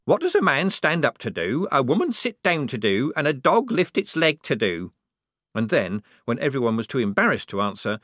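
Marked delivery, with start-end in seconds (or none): 0.0-4.9 higher riddle-telling voice; aunt's joke. A narrator's reading. Tipsy aunt posing her one joke, higher riddle voice. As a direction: put the riddle loudly to the company. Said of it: "she had one joke".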